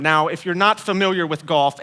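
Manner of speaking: ordinary talk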